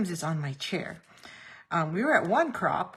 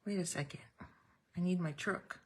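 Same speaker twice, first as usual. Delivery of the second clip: quiet mutter to self; 6 dB quieter than usual. Retold or imitated. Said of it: "I'm like".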